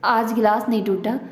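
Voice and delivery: ordinary delivery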